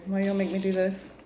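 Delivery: ordinary talk, nothing marked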